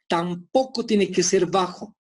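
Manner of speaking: ordinary delivery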